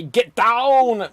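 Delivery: loud, high mock action-hero "Get down"; host mimicking Corey's "Get down", loud and high. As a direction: belt out "Get down" the way Corey did. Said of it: "with Corey Birch".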